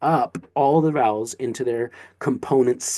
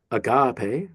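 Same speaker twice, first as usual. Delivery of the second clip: "agape" as a-GAH-pay; "agape" with the middle vowel accented and lengthened; stress on middle syllable. Shown as a demonstration. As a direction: lean on the GA in "agape". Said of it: "it feels different if you say".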